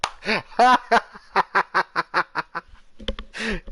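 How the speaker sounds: helpless high belly laugh; long loud laugh. Real, not acted. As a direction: howl with laughter. Long laugh at trophy name.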